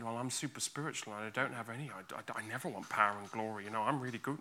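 quick, soft self-excusing patter; pretend piety. Believer pretending to be super spiritual, mock pious. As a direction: rattle it off softly, feigning goodness. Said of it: "than pretend".

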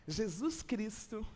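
usual speaking voice

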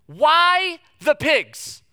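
WHY the pigs said high, loud and slow; exasperated slow demand. Shown as a demonstration. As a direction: stretch out Why the pigs in disbelief.

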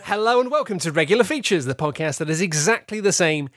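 bright, high show-host greeting; podcast host intro. Acted. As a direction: welcome everyone like a radio host.

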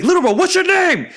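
very high-pitched shouted question; insistent yell. Retold or imitated. Big bro testing little bro for concussion, high insistent shouting. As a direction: yell it as an imitated exasperated big brother.

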